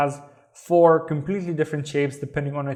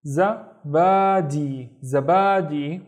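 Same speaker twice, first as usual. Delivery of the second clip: slow, clear pronunciation; much slower than usual. Shown as a demonstration. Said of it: "teacher models the word".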